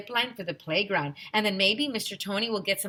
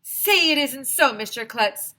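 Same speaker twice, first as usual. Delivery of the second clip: higher, louder pleading shout; shouting kid; 6 semitones higher, 7 dB louder than usual. A narrator's reading. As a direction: beg him to deny it. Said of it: "shouted one of the kids".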